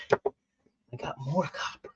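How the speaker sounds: slow unvoiced whisper; hushed secret about the copper. Real, not acted.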